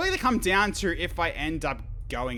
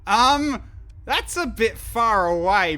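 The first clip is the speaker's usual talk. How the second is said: still stunned; 11 semitones higher, 12 dB louder, slower than usual. Real, not acted. Reacting to far destination.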